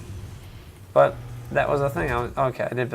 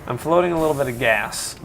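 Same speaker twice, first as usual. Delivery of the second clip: ordinary delivery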